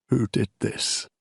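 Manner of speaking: quiet, broken, terrible voice; a hushed, cracked demand. A narrator's reading. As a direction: let the voice crack under grief. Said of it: "His voice is a terrible thing, cracked and broken".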